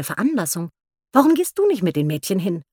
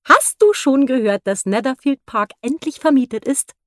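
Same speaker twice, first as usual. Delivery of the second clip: Mrs Bennet; 6 semitones higher, 4 dB louder than usual. A narrator's reading. Mrs Bennet voiced in novel.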